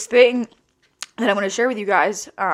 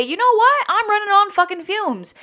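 much higher, voice cracking open on the admission; pitched-up cry of being burnt out; exasperated confession; 10 semitones higher than usual. Retold or imitated. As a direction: blurt it out high, like finally admitting you're drained. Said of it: "vulnerable enough to say".

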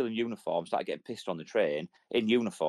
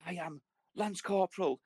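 self-important corporal; 8 semitones higher than usual. Retold or imitated. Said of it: "He's like".

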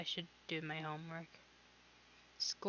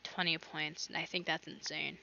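ordinary talk

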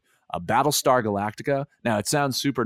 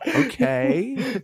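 squeaky nerd okay; 13 semitones higher than usual. Pretend. Playing nerd solving math.

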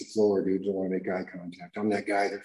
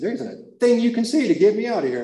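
much higher, louder swagger; cocky boast; 12 semitones higher, 6 dB louder than usual. Retold or imitated. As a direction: brag he can't be moved. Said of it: "I said".

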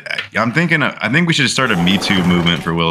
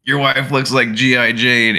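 lightly put-on comic's voice, higher; a hint of a stage comedian, pitched up; mock comedian; 6 semitones higher than usual. Retelling a comedian's joke, lightly mocking higher voice. Acting out Chris Rock's joke.

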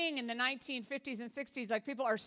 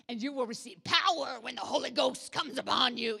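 louder mock old-time preacher; hammed-up revival preacher delivery; weird preacher voice; 4 dB louder than usual. Shown as a demonstration. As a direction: belt it out like a King James preacher. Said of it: "people said it in that weird voice".

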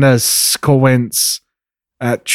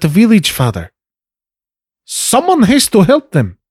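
indignant pleading daughter. Acted. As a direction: protest to your father, shocked then conceding. Plays Trinity arguing with father.

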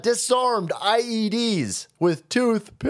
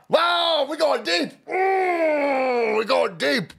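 mock crying; 8 semitones higher, much slower than usual. Mock crying over Will.

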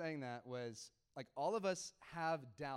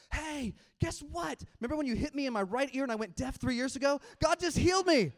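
loud, high, exultant imitation; ecstatic shouting; 10 semitones higher, 12 dB louder than usual. Pastor imitating healed girl phoning her best friend, ecstatic shouting. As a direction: build to a shrieked punchline.

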